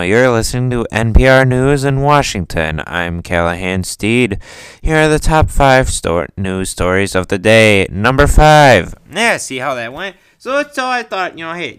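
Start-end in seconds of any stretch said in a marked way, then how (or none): 0.0-8.9 low, close-mic radio anchor read; deep, measured public radio newsreader; NPR news voice. Retold or imitated. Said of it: "I feel like I'm at N P R right now".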